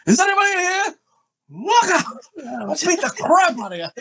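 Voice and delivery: very high, hammed-up theatrical cry; over-the-top. Someone always calling out in a dark room, over the top. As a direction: pitch it way up like a flamboyant film hero. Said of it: "it's just always over the top if you do it".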